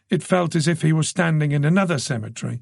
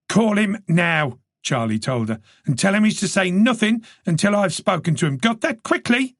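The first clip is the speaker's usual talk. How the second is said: urgent, higher orders; commanding detective; 8 semitones higher than usual. A narrator's reading. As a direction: bark out the instructions. Charlie giving orders.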